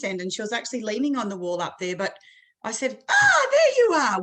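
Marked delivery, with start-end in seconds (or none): 3.1-4.2 high, loud, drawn-out cry of discovery; playful found-you cry. Retold or imitated. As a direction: sing out as if catching a hider. Re-enacts surprising the hiding child.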